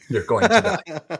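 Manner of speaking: high, loud, drawn-out imitation; hammed-up warning, slow and high; mock dire warning. Echoing the woman's dire warning.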